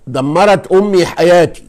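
دمرت امي حياتي loud and slowed, stress on دمرت; emphatic correct phrasing. Shown as a demonstration. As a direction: proclaim دمرت first, slow and loud. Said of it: "الصح ايه".

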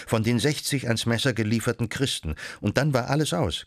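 usual speaking voice

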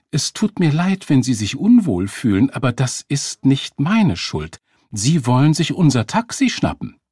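high, loud, self-righteous retort; indignant accusation. A narrator's reading. Woman blaming a man for grabbing their taxi, indignant. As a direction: accuse him, pitched high and forceful.

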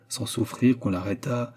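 ordinary delivery, nothing marked

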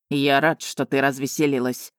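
low, cool and detached; almost indifferent. A narrator's reading. Offended dragon to laughing girl, cool and flat-toned. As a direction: say it coldly, unmoved. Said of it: "почти равнодушным голосом".

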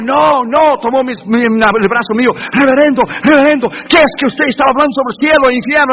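high, pleading cries of a scared passenger; begging the reverend in fright; terrified passenger. Retold or imitated. Terrified passenger begging the reverend about heaven and hell. Passenger screams, grabs arm.

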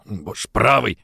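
ordinary delivery

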